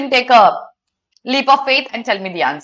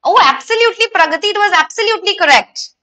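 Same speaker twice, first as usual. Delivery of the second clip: excited praise; 9 semitones higher, 4 dB louder than usual. Real, not acted. Teacher praising a student's answer.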